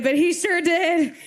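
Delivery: ordinary delivery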